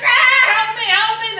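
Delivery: high, loud yelling dwarf; dwarf crying for help. Acted. Trapped dwarf calls to the girls, loud high cry. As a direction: wail for help like a trapped little man.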